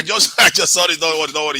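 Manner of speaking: apologizing through laughter; laughing. Real, not acted. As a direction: laugh while saying sorry.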